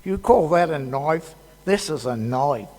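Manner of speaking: put-on lightly done Australian accent, tough-guy swagger; a quick playful Australian Crocodile Dundee bit. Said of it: "no Crocodile Dundee".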